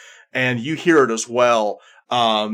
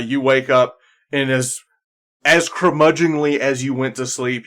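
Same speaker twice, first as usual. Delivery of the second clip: usual speaking voice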